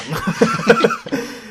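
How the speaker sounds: squealing high laugh; high-pitched laugh burst. Real, not acted. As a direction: crack up at your own joke. Laughing at own impression.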